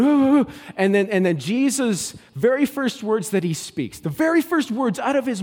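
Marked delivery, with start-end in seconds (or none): 0.0-0.7 high, loud mock panting; hammed-up frantic gasps. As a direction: act out breathless frantic women. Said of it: "running around going".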